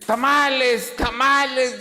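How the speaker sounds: loud, high tamale seller's call; street vendor cry. Retold or imitated. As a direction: imitate a hawker shouting down the street. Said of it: "Es como aquel que vende".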